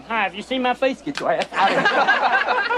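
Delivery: high, slow mock Southern politician; lightly voiced Wallace; George Wallace. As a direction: greet then shoo off the crowd. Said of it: "George Wallace campaigning in Harlem".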